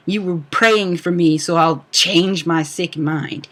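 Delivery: ordinary talk, nothing marked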